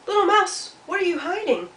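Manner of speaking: high, loud sing-song question; curious Turtle. Acted. Turtle asks Mouse what is hidden, high and curious. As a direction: call to Mouse inquisitively.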